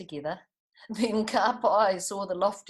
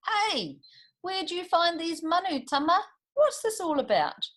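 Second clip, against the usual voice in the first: much higher, eager questions; family rushing out curious; excited family; 11 semitones higher, 4 dB louder than usual. A narrator's reading. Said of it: "they all came rushing out".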